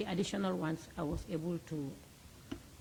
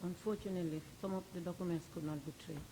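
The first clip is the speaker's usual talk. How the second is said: quiet; 6 dB quieter than usual. Real, not acted. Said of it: "your voice is very low".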